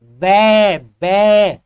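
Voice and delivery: बे pressed loud through closed lips; lip sound बे. Shown as a demonstration. Teacher shows students lips meeting on बे. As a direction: pop बे firmly with lips meeting. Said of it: "आप देखो".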